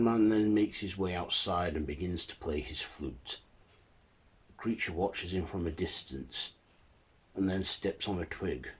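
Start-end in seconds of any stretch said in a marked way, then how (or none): none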